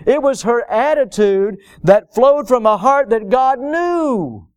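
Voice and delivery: high-pitched, forceful sermon delivery; impassioned preaching. Real, not acted. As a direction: lift the voice and press the point home.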